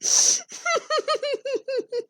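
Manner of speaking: high giggling laughter; laughing fit. Real, not acted. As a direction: laugh helplessly.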